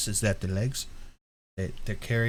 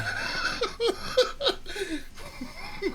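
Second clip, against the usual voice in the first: shrieking laugh; 4 dB quieter than usual. Real, not acted. Loud high laugh.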